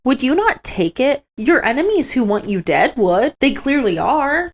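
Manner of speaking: high, loud sneering put-on voice, mocking and accusing; mocking Falcon Matthias. Falcon Matthias accusing Helena at council, high sneering mock voice. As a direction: ham up a self-righteous bully lecturing Helena. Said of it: "Falcon Matthias is like".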